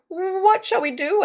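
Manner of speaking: high, slow worried piglet; anxious question. A narrator's reading. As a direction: ask it slow and high, fretting. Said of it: "asked Boris".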